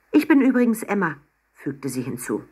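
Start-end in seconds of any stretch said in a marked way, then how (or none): 0.1-1.2 eager introduction. A narrator's reading. Girl eagerly introduces herself.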